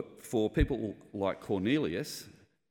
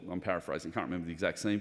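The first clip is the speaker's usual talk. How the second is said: ordinary delivery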